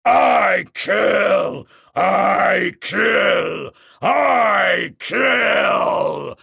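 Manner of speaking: loud shouted battle chant; war cry. Acted. The king ape charging again, shouted war cry. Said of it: "shouting his war cry".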